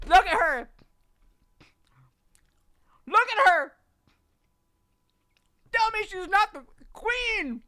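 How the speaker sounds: high, loud adoring gush; gushing excitedly. Real, not acted. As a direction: swoon over her loudly. Gushing over favourite summon.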